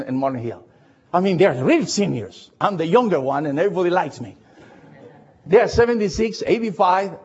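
ordinary delivery

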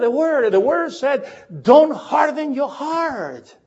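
high preacher's passion building to a shout; fervent confession. Real, not acted. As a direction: build from confession to a pulpit cry.

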